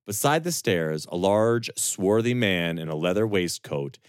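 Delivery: ordinary delivery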